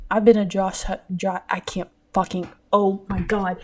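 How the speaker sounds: ordinary delivery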